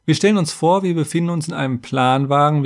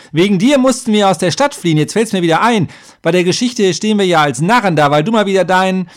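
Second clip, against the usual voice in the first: high, loud, heated accusation; angry snapping; 8 semitones higher, 6 dB louder, faster than usual. A narrator's reading. Half-gnome berates the warrior for their flight, angry snapping. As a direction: lash out as the grievance comes back. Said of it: "schnauzte Hook plötzlich".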